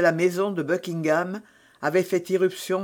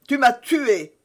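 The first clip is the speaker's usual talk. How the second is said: loud, raised accusation; anguished cry; 6 semitones higher, 5 dB louder than usual. A narrator's reading. Buckingham accusing his attacker, high anguished cry. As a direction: cry out as one just stabbed. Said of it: "cria Buckingham".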